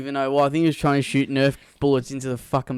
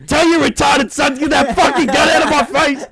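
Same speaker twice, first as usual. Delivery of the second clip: loud, raised mock yell; acted angry dad; 11 semitones higher, 7 dB louder than usual. Dad with a toy gun at his head, acted furious yell. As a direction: holler it like an outraged father.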